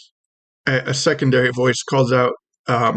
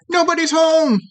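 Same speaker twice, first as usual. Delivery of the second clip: squeaky little creature calling out, very high; tiny high-pitched voice. Acted. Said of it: "tiny high-pitched voice calls out".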